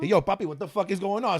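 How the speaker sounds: light Italian-American tough guy imitation of dad; a hint of a Bronx Italian dad shouting; dad's Italian-sounding accent. Son imitates his Puerto Rican dad in an Italian accent. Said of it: "He's Puerto Rican, but he sounds Italian sometimes".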